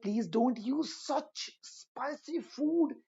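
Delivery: very slow, spaced-out advice; slow model sentence; slow example sentence. Shown as a demonstration. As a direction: say each word slowly for learners. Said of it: "example sentence for students".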